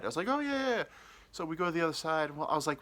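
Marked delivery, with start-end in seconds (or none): 0.3-0.8 much higher hurried yeses; nervous yes. Retold or imitated. Agreeing to go over, much higher nervous yeses. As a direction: agree too fast, nervously.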